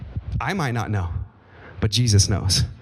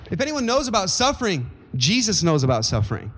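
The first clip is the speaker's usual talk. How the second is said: fervent preaching; 6 semitones higher than usual. Acted. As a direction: preach it out with rising fervour to the congregation. Preacher rising into full preaching.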